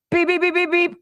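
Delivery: high imitated beeping; mock electronic beep; alarm beeps. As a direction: chirp it like a smoke alarm.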